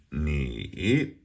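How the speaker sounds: "Ni" sung out with a big dip and rise; exaggerated tones. Shown as a demonstration. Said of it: "We're gonna exaggerate the tones".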